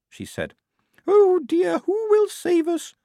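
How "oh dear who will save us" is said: high, slow, louder trembling plea; quavering. A narrator's reading. Blouse on the stuck lift, high and slow in a quavering plea. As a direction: quaver it out like a helpless lady. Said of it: "Blouse quavered".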